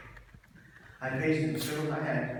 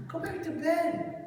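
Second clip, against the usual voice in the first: high, loud, slow mock dad telling him off; louder, high-pitched "Go back to bed"; sleepy dad ordering; 6 dB louder than usual. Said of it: "He goes".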